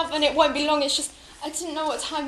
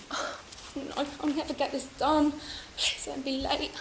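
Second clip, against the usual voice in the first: anxious hush; 2 semitones higher, 10 dB quieter than usual. Acted. Mother hurrying, fearful of husband.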